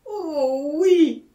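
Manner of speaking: long, swelling high exclamation; loud, drawn-out relieved yes; eager cry. A narrator's reading. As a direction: cry out in desperate agreement. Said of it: "s'écria Jacquemin".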